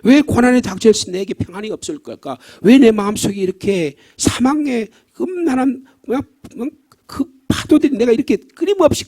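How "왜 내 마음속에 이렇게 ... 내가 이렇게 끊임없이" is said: anguished cry. Retold or imitated. Voicing grieving father's anguish.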